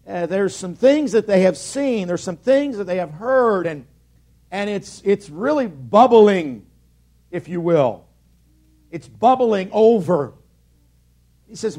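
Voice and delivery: usual speaking voice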